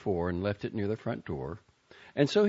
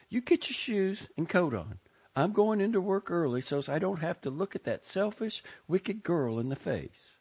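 higher, tearful-tinged Southern aunt; upset Southern aunt; 10 semitones higher than usual. A narrator's reading. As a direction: voice the bitter aunt, pitched up. Aunt Maisie voiced by reader.

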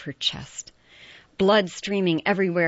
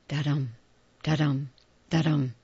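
heartbeat da-dum; 3 semitones lower than usual. A narrator's reading. Heartbeat sound effect chant.

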